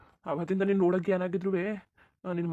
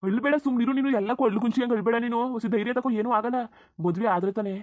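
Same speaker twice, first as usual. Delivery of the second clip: higher, louder urgent comforting; loud consoling; 5 semitones higher, 5 dB louder than usual. Acted. Lover on the phone begging her to stop crying, loud and urgent. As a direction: talk her out of crying, firmly.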